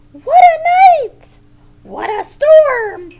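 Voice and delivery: high, loud bird voice; squeaky little bird exclaiming; twittering wren. A narrator's reading. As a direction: chirp it out like a tiny excited wren. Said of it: "Twitters wren".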